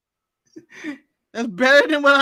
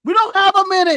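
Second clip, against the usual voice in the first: urgent; 8 semitones higher, 6 dB louder, faster than usual. Acted. Acted hacking scene.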